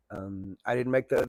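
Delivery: ordinary talk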